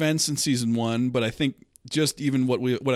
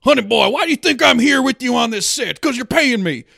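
high, loud mock Southern drawl; Shia LaBeouf's southern drawl; 10 semitones higher, 6 dB louder than usual. Film dad tells his son why he's there, mock southern drawl. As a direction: put on an exaggerated Southern accent, pitched way up. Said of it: "like, southern drawl".

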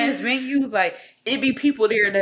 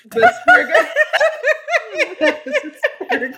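squeaky put-on voice, much higher and louder; high cartoon squeal for a laugh; silly mock voice; 5 dB louder, much slower than usual. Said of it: "is this Sabria or somebody named Carl".

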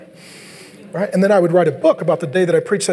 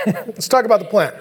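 ordinary talk, nothing marked

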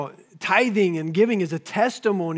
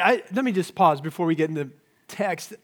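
usual speaking voice

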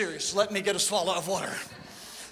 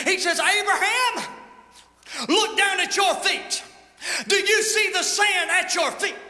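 full-force preaching; 8 semitones higher, slower than usual. Acted. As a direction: proclaim it up high.

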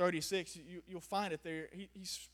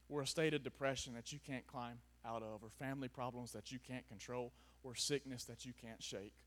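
soft pastoral appeal; 6 semitones lower, 5 dB quieter than usual. Acted.

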